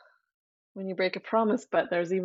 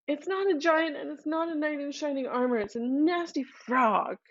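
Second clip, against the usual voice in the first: put-on higher girlish complaint; princess voice; 7 semitones higher than usual. Princess answers her father.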